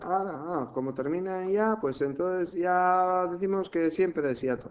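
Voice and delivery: mock naive conclusion. Teacher imitating a pupil's wrong assumption about hiatus, mock naive. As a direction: play a learner jumping to conclusions. Voicing a mistaken learner's hasty conclusion.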